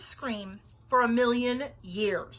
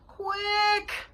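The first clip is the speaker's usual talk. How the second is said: high, loud plaintive moan; 11 semitones higher, 7 dB louder than usual. A narrator's reading. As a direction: moan it urgently, drawn out. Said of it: "moaned Bean".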